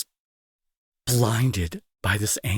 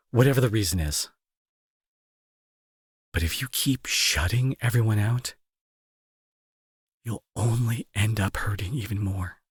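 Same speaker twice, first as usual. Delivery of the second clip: ordinary talk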